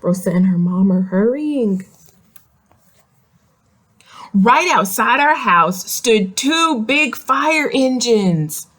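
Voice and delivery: plain reading voice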